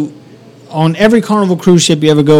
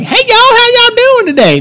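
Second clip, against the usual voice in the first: put-on Southern accent. Georgia man greeting folks, exaggerated Southern accent. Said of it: "based solely on his accent".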